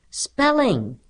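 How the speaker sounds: high-pitched call from the class; children's cry. A narrator's reading. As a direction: cry out like pupils answering. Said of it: "cried the children".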